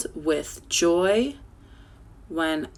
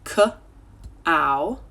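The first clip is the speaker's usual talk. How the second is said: "K-ow" stretched into its two sounds. Shown as a demonstration. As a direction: draw out "K-ow" slowly. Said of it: "Stretch out cow".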